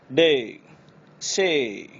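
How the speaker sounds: डे ending on a clear ei. Shown as a demonstration. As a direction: end on the ei glide. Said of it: "एई की आवाज़ आनी चाहिए". (अंत में एई)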